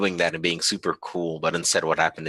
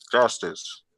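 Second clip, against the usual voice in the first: far-off call; 5 semitones higher than usual. Acted. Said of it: "you just hear from the distance".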